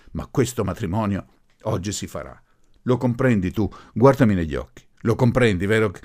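forceful, emphatic demands; angry insistence. A narrator's reading. Professor insisting the wedding will happen today, angry. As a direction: press the point with rising force. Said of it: "pestò rabbiosamente un piede, gridando".

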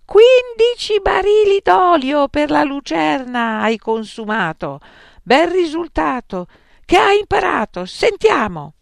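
father bawling out his failed son. Acted. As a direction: yell at your son.

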